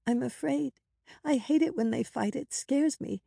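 put-on high, small child's voice; timid, high-pitched younger sister; scared little girl. A narrator's reading. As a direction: confide fear in a high little voice. Frightened little girl confides.

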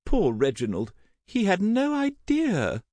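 high, plaintive mourning; pitying widow's lament; tearful. A narrator's reading. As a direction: wail it gently, pitch soaring. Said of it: "eyes suddenly glinting with tears".

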